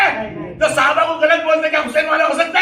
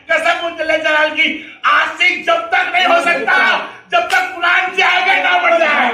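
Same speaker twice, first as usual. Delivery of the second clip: slow, rising sermon cry; full-force preaching; 5 semitones higher, slower than usual. Acted. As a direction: preach it out to the crowd. (پورے جوش سے خطابت)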